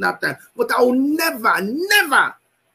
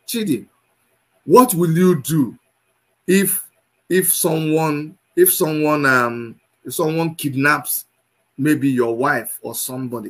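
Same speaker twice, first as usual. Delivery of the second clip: low, halting retold question; imitating an interviewer; 8 semitones lower than usual. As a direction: quote the questioner in a lowered, hesitant voice. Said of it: "somebody asked me".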